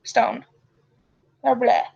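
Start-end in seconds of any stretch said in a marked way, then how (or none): none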